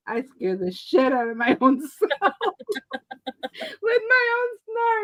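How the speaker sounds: high, breaking into laughter; can barely get it out for laughing; laughing quote. Retold or imitated. Brother admitting he scared himself, laughing high.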